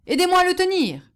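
calling for help. A narrator's reading. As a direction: call out urgently for a hand. Frightened peasant calls for help.

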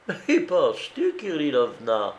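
high-pitched exclamation, falling on the name; exclaimed reproach; crying out. A narrator's reading. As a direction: protest to the maid, scandalized. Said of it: "s'écria madame Kuntze".

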